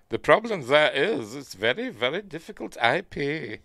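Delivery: imitating Emmerich in a lightly put-on German accent; a hint of a German director's accent. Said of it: "I won't do his German accent".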